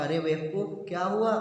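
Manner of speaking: ordinary delivery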